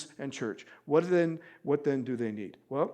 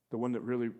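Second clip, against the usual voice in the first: usual speaking voice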